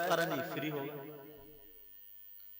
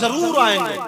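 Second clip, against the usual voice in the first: preaching at full force; 8 semitones higher, 13 dB louder than usual. Acted. Preacher crying out the saint's story to the crowd, full force. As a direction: pour it out to the congregation.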